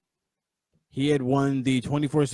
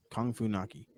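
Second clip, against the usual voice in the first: muttered fondly; 4 semitones lower, 9 dB quieter than usual. Real, not acted. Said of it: "I love it".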